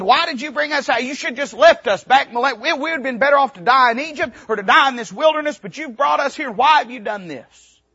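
high, fast complaining whine; whining people. Retold or imitated. Preacher imitating Israel complaining to Moses, high-pitched whine. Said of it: "whining and moaning and complaining".

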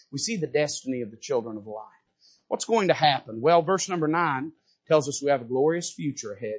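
usual speaking voice

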